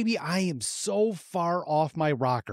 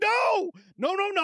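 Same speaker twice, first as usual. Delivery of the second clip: alarmed no; 13 semitones higher than usual. Retold or imitated. Hushing kid's new word.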